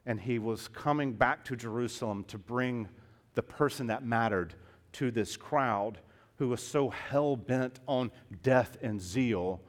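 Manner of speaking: ordinary talk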